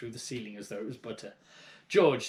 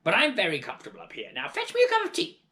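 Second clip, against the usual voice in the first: fast then very high, loud hag command; bossy crone; 13 semitones higher, 6 dB louder, faster than usual. Acted. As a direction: order him about. Grandma bossing.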